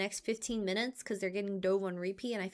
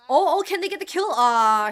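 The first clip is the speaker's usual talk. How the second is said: excited yell; 4 semitones higher, 11 dB louder than usual. Real, not acted. Reacting to a teamfight kill.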